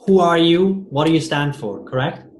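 ordinary talk, nothing marked

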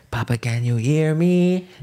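high, slow sung plea. Retold or imitated. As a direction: sing the hook up high and drawn out. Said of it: "Yeah, I've heard N-Dubz".

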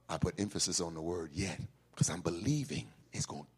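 soft, low and fast; low quick aside. Acted. Preacher dropping to an aside about the word yet. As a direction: drop to a hurried murmur.

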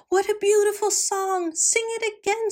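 high, excited cheer; cheering millers. A narrator's reading. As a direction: cheer the bird on.

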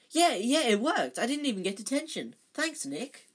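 high and loud, rushing with relief; a relieved boy thanking a ghost, high and excited. Acted. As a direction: gush with delight, a bit fast. Harry thrilled after escaping punishment.

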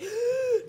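sharp high gasp of fright; mock gasp of fright. Acting out her fear of scorpions, a sharp gasp.

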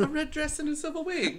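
high-pitched, performed quote; catchphrase impression. Quoting a catchphrase in put-on voice.

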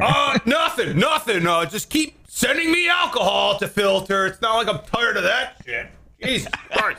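high, strained comic protest; put-upon organ voice. Acted. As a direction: play an overworked organ covering up its grumbling. Acting an overworked organ.

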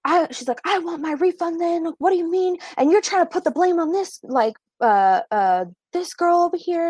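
high, shrill-pitched angry customer yelling; mock screaming customer. Customer yelling at the cashier, high mock screaming. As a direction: screech it like an irate customer demanding a refund. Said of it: "starts screaming at my coworker".

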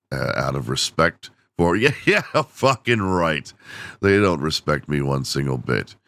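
usual speaking voice

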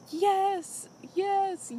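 high, loud retold yell of joy; hysterical celebrating. Podcaster acting out the contestant landing her fish, hysterical joy. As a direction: imitate an overjoyed victory cry. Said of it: "yelling and, like, hysterical".

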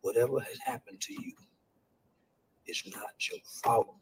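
quiet whispered comfort; hushed reassurance. Shown as a demonstration. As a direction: keep it hushed and tender for a hurt child. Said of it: "That's one of the first things I want kids to know".

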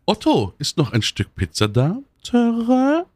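hopeful cartoon elephant, questioning trumpet at the end; ask, then trumpet it high as a question; Benjamin Blümchen. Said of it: "nach Stimmung". (fragender Elefant mit hohem Tröten)

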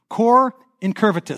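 teacher modelling Cor incurvatus for the class; Latin phrase demo. Shown as a demonstration. Pastor teaching the congregation Latin, careful model phrase. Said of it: "teach you a Latin phrase".

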